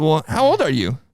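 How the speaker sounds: ordinary delivery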